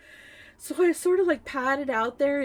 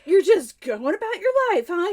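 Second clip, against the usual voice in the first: higher, louder retold challenge; indignant accusation; 7 semitones higher, 6 dB louder than usual. Wife confronting unbothered husband, indignant accusation. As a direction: accuse them, hammed-up and affronted.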